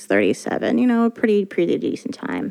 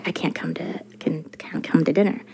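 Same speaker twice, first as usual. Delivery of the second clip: whispered; 4 semitones lower, 6 dB quieter than usual. Retold or imitated. Quoting her own text.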